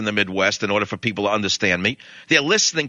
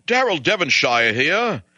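slow, clipped upper-class English intro; English accent; 3 semitones higher, slower than usual. Retold or imitated. English professor introducing himself to the American chairman in an English accent. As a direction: announce yourself like a stiff English gentleman, slowly. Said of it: "the Englishman walked in and said".

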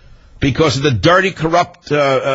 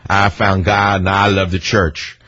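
slow, lower mock-pious voice; mocking Hillary; 5 semitones lower, slower than usual. Host mocking Hillary's church talk, slow and pious. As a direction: drawl it out like a fake convert. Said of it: "going before the Methodists and talking about".

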